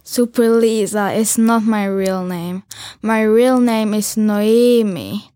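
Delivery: ordinary talk, nothing marked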